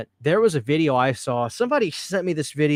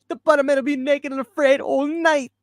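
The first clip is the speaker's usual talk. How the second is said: high-pitched, exaggerated Jamaican accent; full Jamaican accent; 12 semitones higher, 3 dB louder than usual. Host quoting the shirt promo clip, full mock Jamaican accent. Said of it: "It's full Jamaican".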